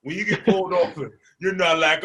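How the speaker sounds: ordinary talk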